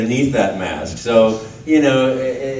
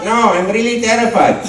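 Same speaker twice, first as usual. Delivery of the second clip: higher, laughing timid voice; scared Luigi; 8 semitones higher than usual. Retold or imitated. Luigi refusing from the kitchen, a mock timid voice. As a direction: play Luigi as mock terrified. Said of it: "and they say".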